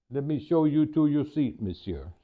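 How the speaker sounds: put-on amateur French accent, courteous head waiter; attempted French accent. Maitre d' greeting a diner, attempted French accent. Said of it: "Forgive my French attempt here".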